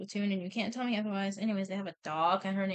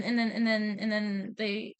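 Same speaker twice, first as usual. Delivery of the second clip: ordinary talk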